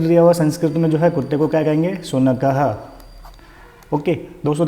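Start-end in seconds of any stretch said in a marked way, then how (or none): 2.0-2.8 शुनकः with an audible "ha" at the end; sounded visarga. Shown as a demonstration. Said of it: "ह की ध्वनि निकलेगी जब भी उच्चारण करोगे".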